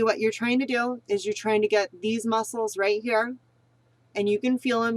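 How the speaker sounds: ordinary talk, nothing marked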